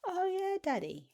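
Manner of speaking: put-on squeaky childlike "oh" dropping back down; a small child's delighted answer; high baby-voice "oh yeah". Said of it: "when I was using that baby language".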